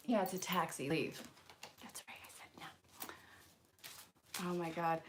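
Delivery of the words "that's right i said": breathy, conspiratorial whisper. Acted. Reader sassing a taxi card to viewers, whispering. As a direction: drop to a sly whispered aside. Sassy aside to the audience.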